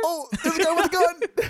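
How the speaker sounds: high squeaky mock terror; mock fright. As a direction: squeal it like a cartoon scare. Escalating mock panic.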